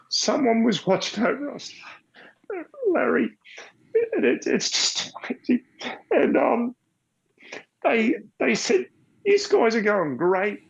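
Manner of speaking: high, tearful voice close to crying; fighting back tears while telling it; choked up. Real, not acted. To the host, grateful for surviving the crash, choking up.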